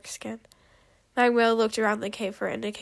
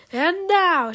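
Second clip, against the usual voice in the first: loud, high showy announcement; big swelling announcer call; announcing; 7 semitones higher, 8 dB louder than usual. A narrator's reading. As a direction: proclaim it like an announcer. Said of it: "she announced".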